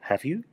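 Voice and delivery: "Have you" rising high at the end; rising intonation. Shown as a demonstration. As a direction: ask "Have you" as a genuine question, pitch climbing. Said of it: "con un tono ascendente".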